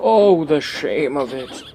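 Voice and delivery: slow, hammed-up lament; mock shame. A narrator's reading. Proud engine lamenting his dirty coal trucks. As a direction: wail about the shame. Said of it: "he grumbled".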